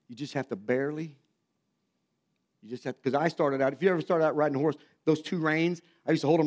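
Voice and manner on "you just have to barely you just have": softer, low, coaching gently; gentle dad. Retold or imitated. Father quietly teaching his son to hold the reins, gentle and soft. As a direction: ease the words out like a patient father. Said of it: "Dad would say".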